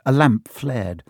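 ordinary delivery

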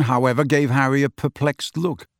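ordinary delivery, nothing marked

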